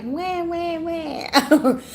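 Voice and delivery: high mock crying wah wah wah; mock wailing. Shown as a demonstration. Telling viewers how she can't cry gently, mock wailing. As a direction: wail it like a baby. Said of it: "don't know how to just be like".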